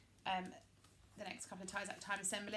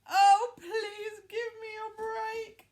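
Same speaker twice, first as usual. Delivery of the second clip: much higher, loud, slow tearful wail; crying plea; 10 semitones higher, 11 dB louder, slower than usual. A narrator's reading. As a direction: beg for your life in tears. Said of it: "She cried".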